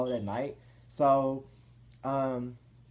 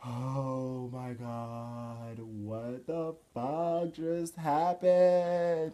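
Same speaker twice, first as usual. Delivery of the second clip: shaken disbelief; much slower than usual. Retold or imitated. Driver after parking, shaking in fear, drawn-out disbelief. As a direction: drag out every word, starting hushed and climbing.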